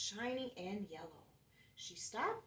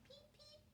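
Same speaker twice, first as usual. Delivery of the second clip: tiny, very high and soft peep peep; chick peeps; 24 dB quieter than usual. Acted. Chick calls to the truck with high peeps. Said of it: "calf called".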